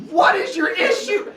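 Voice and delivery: high, loud, laughing exclamation; mock outburst. Teacher reacting to a kid kicking a desk over, loud and high. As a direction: burst out at the chaos, half laughing.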